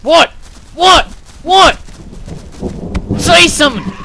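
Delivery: loud, high demanding shout; yelling at him. Real, not acted. Gamer shouting at a silent villager to speak. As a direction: demand an answer at the top of his voice.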